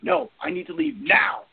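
mock-urgent, drawn-out insistence; urgent demand. Retells LaBeouf's urgent demand to leave.

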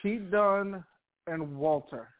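ordinary talk

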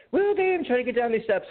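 high-pitched mock woman's voice; imitating the nice neighbor. Nice neighbor struggling down the steps, imitated high-pitched friendly voice. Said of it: "she was being so nice".

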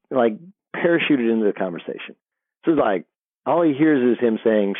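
ordinary talk, nothing marked

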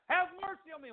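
high-pitched imitated cry; retold beggar's plea, pitched high. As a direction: cry out high, without extra volume. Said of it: "So he's crying out".

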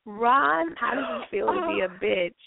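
normal talking voice

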